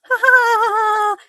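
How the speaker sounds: high, long stagy laughter; acted mocking laugh. Engine jeering at another in the shed, high mocking laugh. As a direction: laugh tauntingly, held long.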